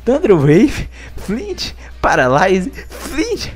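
squealing each word high; shrieking jackpot winner. Real, not acted. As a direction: shriek every lucky hit. Recounting each lucky flinch.

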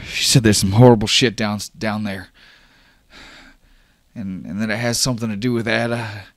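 high-pitched, building to loud exasperation; worked-up young man, voice climbing high; worried, agitated Oscar. Acted. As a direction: demand answers, increasingly upset. Plays Oscar confronting Dash.

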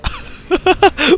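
high-pitched burst of laughter. Real, not acted. Player laughing after a play, high laugh. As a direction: crack up in a high voice.

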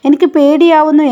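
high, loud frightened cry; imitating a scared man. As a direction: blurt it out in fear. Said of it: "എന്ന രീതിയിൽ".

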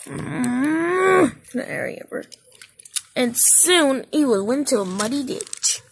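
plain reading voice

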